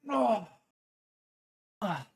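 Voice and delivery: ordinary talk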